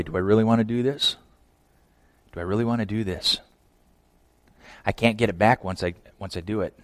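soft, low inner deliberation; acted private doubt, voice dropped low; hushed self-questioning. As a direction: think it through quietly to yourself. Acts out Mary's private deliberation.

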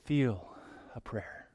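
soft dreamy sigh of a wish; mock a romantic daydreaming pray-er; airy whimsical mock prayer; dreamy wistful prayer. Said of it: "Anne of Green Gables prayer".

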